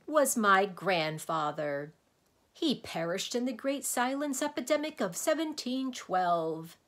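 high, loud mournful lament; anguished. A narrator's reading. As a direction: lament a lost relative dramatically. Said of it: "cried the anguished din".

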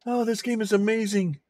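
normal talking voice

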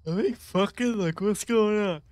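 talking through a yawn. Real, not acted. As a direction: stretch the words out mid-yawn. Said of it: "I was yawning".

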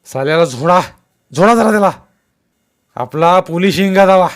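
loud, high furious command; bellowed order. A narrator's reading. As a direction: yell at your men to attack. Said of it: "सुकाजीवर डापरला".

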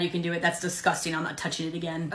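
normal talking voice

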